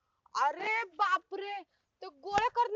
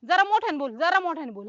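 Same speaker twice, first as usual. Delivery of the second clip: loud demand; 3 semitones lower, 7 dB louder than usual. Acted. Can't hear, demands louder speech.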